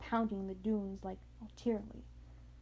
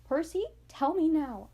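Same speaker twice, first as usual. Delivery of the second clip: firm demand, raised on the second try; mildly acted stern order, higher and louder; 5 semitones higher, 5 dB louder than usual. A narrator's reading. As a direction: demand an answer like a stern mother. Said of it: "looked at me sternly and talked in a tone".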